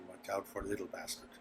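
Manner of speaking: very soft, low grumbled warning; low mumbling. Acted. Innkeeper privately warning the guide, low mumble. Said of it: "you can hear him mumbling".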